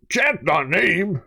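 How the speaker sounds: high, slow dim monster voice. Retold or imitated. Man at a flat tire, fake-lifting the car, in a slow monster voice. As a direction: play the big simple monster. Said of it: "and I'll go".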